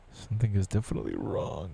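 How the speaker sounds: normal talking voice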